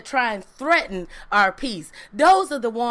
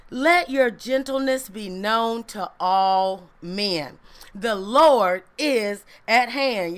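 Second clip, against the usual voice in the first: slow, weighty reading of the verse; solemn scripture reading; 4 semitones lower, slower than usual. Acted. Preacher to congregation, slow solemn verse reading. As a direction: lean into each word of scripture.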